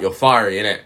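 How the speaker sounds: ordinary talk, nothing marked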